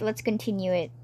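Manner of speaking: ordinary delivery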